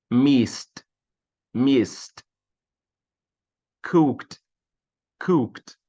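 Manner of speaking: each word alone, final "ed" as a crisp t; repeat-after-me T endings. Shown as a demonstration. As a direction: model "Looked" as lookt, leaving room to repeat. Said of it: "Repitam aí comigo".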